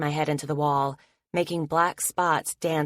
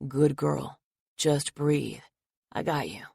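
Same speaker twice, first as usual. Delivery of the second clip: soothing; 2 semitones lower, slower than usual. Acted. Logan cradling Anna after her panic attack, soothing. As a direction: hold her safe with your voice. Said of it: "soothing voice".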